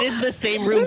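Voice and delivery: ordinary talk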